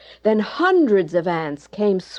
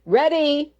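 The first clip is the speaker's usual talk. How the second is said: called out; 8 semitones higher than usual. A narrator's reading. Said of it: "the woman cried".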